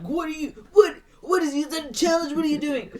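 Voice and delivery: high, loud mock outrage; hammed-up baffled scolding; indignant raptors. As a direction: squawk it in pretend indignation. Acting confused indignant raptors.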